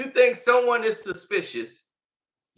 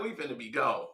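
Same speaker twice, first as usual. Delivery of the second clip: scared aside; 10 semitones lower, 11 dB quieter than usual. Retold or imitated. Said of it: "I'm like".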